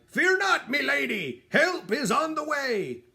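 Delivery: a knight bravely calling to the rescue; heroic cry. A narrator's reading. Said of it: "he cried".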